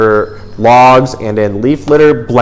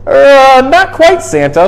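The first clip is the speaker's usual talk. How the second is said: much higher, hesitant elf squeak; little old elf; 13 semitones higher, 4 dB louder, slower than usual. A narrator's reading. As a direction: pipe up like a worried tiny elf. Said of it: "said the little old elf".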